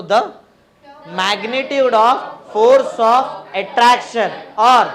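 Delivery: loud, very slow, stretched-out chant; drawn-out syllables, left hanging for students to finish; class recitation. Real, not acted. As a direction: lead the class word by word. Leading class in reciting statement.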